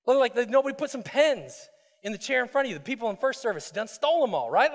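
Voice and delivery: ordinary talk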